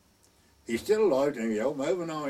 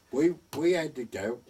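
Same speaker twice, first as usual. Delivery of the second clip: ordinary talk, nothing marked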